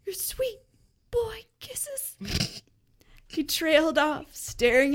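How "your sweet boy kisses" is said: higher, breathy whisper; mock-tender whisper. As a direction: drop to a secret whisper. Tender trailing line.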